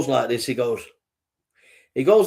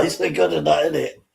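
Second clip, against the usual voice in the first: ordinary delivery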